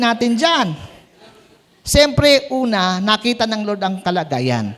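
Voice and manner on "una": ordinary delivery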